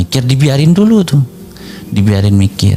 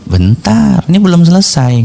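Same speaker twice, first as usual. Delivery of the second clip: retold interruption; 6 semitones higher than usual. Older brother stopping a sibling from ending the debate, retold interruption. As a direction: halt the other debater with a raised pitch.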